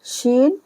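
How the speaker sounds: शीन said clearly, with an sh sound; शीन, an Urdu letter name with a clear sh. Shown as a demonstration. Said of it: "इस तरह पढ़ेंगे शीन".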